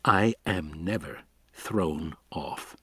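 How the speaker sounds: icy, slow and quiet; cold. A narrator's reading. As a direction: say it coldly, unmoved. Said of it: "Levine said coldly".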